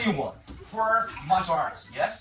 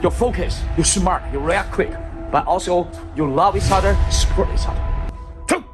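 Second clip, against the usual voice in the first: loud drill commands shouted over music; martial arts instructor; 5 semitones higher, 12 dB louder than usual. Acted. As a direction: bark out the counts like a coach.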